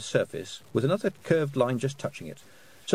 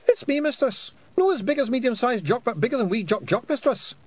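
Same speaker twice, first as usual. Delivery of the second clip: high, loud Scottish pixie; squeaky little Scots fairy; 14 semitones higher, 4 dB louder than usual. A narrator's reading. Pixie figure voice.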